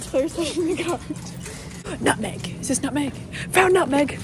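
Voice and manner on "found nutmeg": excited. Real, not acted. Excited find in store.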